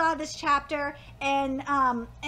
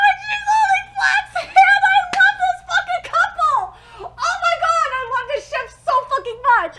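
much higher and louder, laughing and near tears with joy; ecstatic shouting; 7 semitones higher, 10 dB louder than usual. Real, not acted. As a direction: scream your love for the couple. Fangirling over a manga couple.

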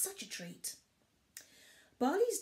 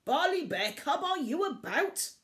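loud, high call into the dark; dad searching, calling out; dad shouting; 8 semitones higher, 6 dB louder than usual. A narrator's reading. Father bear searching the woods, loud shout. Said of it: "he heard a shout".

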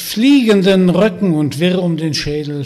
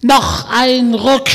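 high, loud, slow screech urging one more pull; screeching witch; 7 semitones higher, 7 dB louder, slower than usual. Acted. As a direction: shriek the pull like a mad hag. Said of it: "Die Hexe kreischte und keifte". (kreischende Hexe, langsam und laut)

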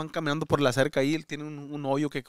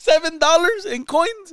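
much higher, louder, slow disbelieving repeat of "Seven dollars in coins?"; incredulous; 13 semitones higher, 10 dB louder, slower than usual. Real, not acted.

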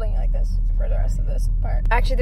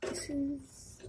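hushed whisper in a store; 13 dB quieter than usual. Real, not acted. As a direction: keep it to a soft whisper.

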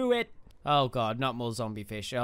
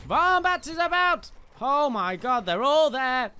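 high, loud battle cry; excited shout; 8 semitones higher, 7 dB louder than usual. Real, not acted. Player charging in as Vombat, shouting excitedly. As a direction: yell it out on the charge.

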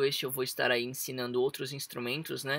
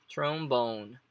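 trombone with the stress on the final BONE. Shown as a demonstration. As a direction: draw out and hit BONE in trombone. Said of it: "A entonação vai entrar aqui no bone". (trombone com a tônica no bone)

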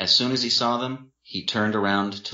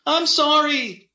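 slow, loud, high-pitched wail; crying out; 6 dB louder, slower than usual. A narrator's reading. Drunk brother shielding his face, crying out. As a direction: wail the apology at the top of the voice. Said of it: "Freddie cried".

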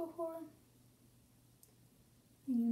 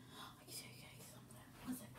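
whispering; 16 dB quieter, faster than usual. Real, not acted. Secret aside to viewers.

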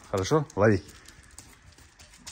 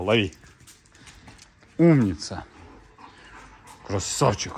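ordinary delivery

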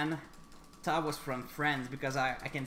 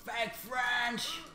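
shouting; 10 semitones higher than usual. Real, not acted. Shouts his own nickname.

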